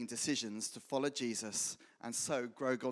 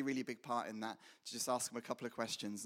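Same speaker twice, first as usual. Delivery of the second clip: ordinary talk, nothing marked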